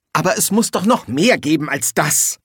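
groaning. A narrator's reading. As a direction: plead with a touch of a groan. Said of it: "stöhnte Schnapper".

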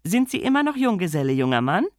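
a lady calling out brightly. Acted.

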